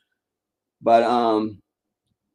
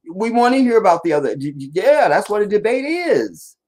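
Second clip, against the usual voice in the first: heated, higher and faster; 7 semitones higher than usual. Real, not acted. Heated rant about debates.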